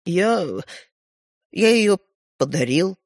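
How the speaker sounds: halting, sheepish confession; embarrassed. A narrator's reading. Стёпа admitting he gave the toy away, embarrassed. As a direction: confess in fits and starts. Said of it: "смущённо признался Стёпа".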